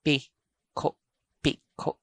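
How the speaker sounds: Piko split into pi' ... ko', both abrupt; piko with glottal stops. Shown as a demonstration. Teacher shows the glottal catch, Piko in two stopped pieces. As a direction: break "Piko" with a catch after each vowel. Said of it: "glottal catch for each vowel".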